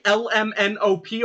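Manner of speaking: L M N O P with each letter separate; L, M, N, O, P as distinct letters. Shown as a demonstration. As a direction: deliberately space out L M N O P. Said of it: "Do you say".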